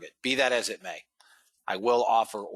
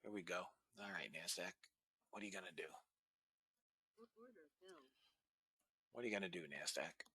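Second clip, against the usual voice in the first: much softer, half to himself; muttering to the market; 20 dB quieter, faster than usual. Real, not acted. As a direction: quietly coax the chart like it can hear you. Muttering to market while watching.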